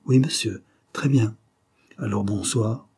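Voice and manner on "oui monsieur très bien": usual speaking voice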